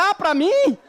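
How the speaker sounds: high, loud laughing disbelief; husband, shocked the ghost should visit him, laughing protest; startled laugh. Retold or imitated. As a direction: burst out, stunned it's you. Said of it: "Eu falei".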